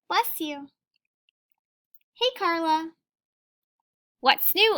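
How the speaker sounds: usual speaking voice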